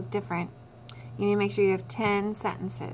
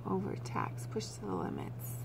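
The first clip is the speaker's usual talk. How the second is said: very soft, under-the-breath reading; barely voiced murmur while looking something up; reading to self; 13 dB quieter than usual. Real, not acted. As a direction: skim the list quietly to yourself. Skimming a synonym list to herself.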